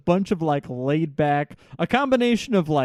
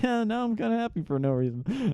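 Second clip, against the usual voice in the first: softly dreamy surfer dude; 7 semitones higher, 7 dB quieter than usual. Retold or imitated. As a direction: say it like a blissed-out surfer. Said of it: "surfer voice thing where it's just like".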